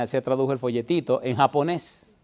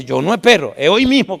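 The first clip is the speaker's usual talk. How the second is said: loud and firm; firm loud resolve; 6 semitones higher, 9 dB louder than usual. Retold or imitated. Daughter telling her compadre she'll be baptized today, loud and firm. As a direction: declare it with no waiting.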